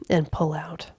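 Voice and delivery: ordinary delivery, nothing marked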